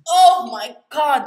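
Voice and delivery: loud, high, drawn-out swoon; gushing outburst. Real, not acted. Woman reacting to a sexy takedown, loud slow gushing. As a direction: gush at full volume, stretching the words.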